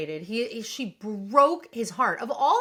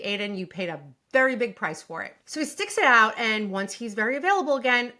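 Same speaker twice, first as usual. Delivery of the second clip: ordinary talk, nothing marked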